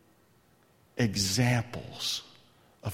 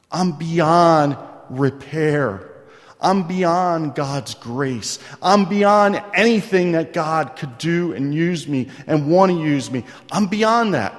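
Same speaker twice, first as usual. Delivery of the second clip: slow, louder pleading lament; mock despair, drawn out and loud; despairing cry; 3 semitones higher, 7 dB louder than usual. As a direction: ham up the hopeless sinner. Voicing despairing young adults.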